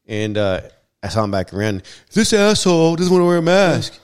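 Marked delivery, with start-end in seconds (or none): 2.1-4.0 loud, higher angry yell at the shopper; retold yelling. Angry customer yelling at maskless shopper, loud high shout. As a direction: bellow it across the store. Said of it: "yelling at the top of his lungs".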